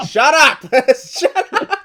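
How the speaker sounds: shrieking laughing shut up; shrill anger. Real, not acted. Teasing partner after verbal slip.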